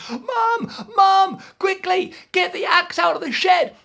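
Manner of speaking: very high, loud call to Mum; high desperate yell; shouting down. Acted. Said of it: "he shouted down".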